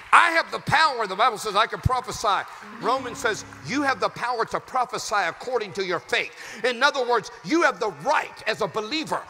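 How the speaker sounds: loud, rising sermon cadence; full-throated pulpit preaching; preaching at full force. Acted. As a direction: build it up like a revival preacher.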